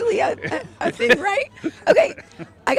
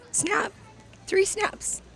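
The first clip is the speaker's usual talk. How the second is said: sassy; 3 semitones higher, much slower than usual. Real, not acted. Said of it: "The way your voice is going".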